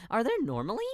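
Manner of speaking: ordinary talk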